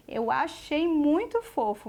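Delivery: slow, tender and fond. Shown as a demonstration. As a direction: coo it fondly to the child. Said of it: "eu sempre costumo dizer assim".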